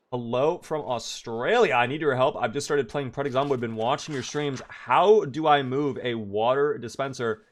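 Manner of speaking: ordinary talk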